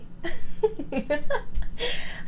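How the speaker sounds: high-pitched, mocking vampire laugh; villainous laugh. Vampire answering a captive who asks why, villainous laugh.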